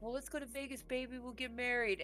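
high, soft mock coaxing; imitated sweet-talk. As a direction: imitate a boyfriend wheedling, voice up and hushed. Imitates boyfriend coaxing her to marry.

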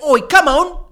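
loud, high mock Cockney shout. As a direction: bark it like a Cockney lad. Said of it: "super Cockney British?".

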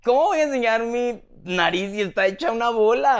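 recalling her outrage at being asked to show her nose; indignant retort. Retold or imitated. Herself, asked to show her swollen nose, answers with high exasperation. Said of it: "Y yo, pues".

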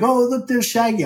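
high-pitched passerby spotting a star; excited fan imitation. Actor imitating fans who recognized him, high and excited. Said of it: "every time somebody like".

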